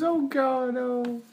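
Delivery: put-on high girly voice with a giggle; man doing a woman's voice. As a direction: squeak it like a giggling girl. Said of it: "And then he did this".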